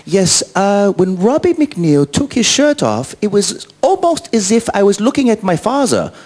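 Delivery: high, slow, earnest fan voice; careful, drawn-out mimicry of a fan; imitated fan. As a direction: deliver it as a solemn high-pitched fan. Voicing same fan's verdict.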